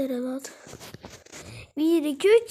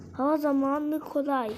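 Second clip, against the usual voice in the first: usual speaking voice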